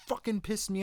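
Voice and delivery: ranting buildup. Real, not acted. Ranting about a hated scene.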